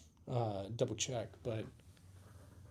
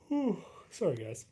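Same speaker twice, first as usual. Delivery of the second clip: yawning; 4 semitones higher than usual. Real, not acted. Streamer apologizing to viewers for a yawn, yawning. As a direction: sigh out a real yawn, voice dropping off. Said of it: "I'm yawning".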